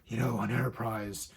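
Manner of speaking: a low, gruff Batman-style rasp, lightly done; mock-menacing growl, half-hearted.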